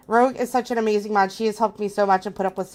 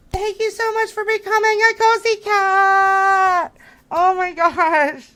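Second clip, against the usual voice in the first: much higher, louder and slow, gushing thanks; thanking a new member, about to flip out; overwhelmed; 8 semitones higher, 5 dB louder, slower than usual. Real, not acted. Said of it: "I'm gonna flip out".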